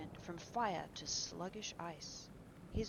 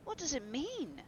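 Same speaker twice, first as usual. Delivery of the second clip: high, louder, startled demand; loud question pitched well up; shrill cry; 9 semitones higher, 5 dB louder than usual. A narrator's reading. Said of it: "cried Hallward at last. His own voice sounded shrill".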